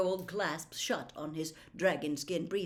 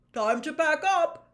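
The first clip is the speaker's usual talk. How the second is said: put-on jovial, booming old professor; plummy teacher inspecting the class's work; Slughorn's voice; 9 semitones higher, 9 dB louder than usual. Slughorn to the class, checking potions, jovial booming.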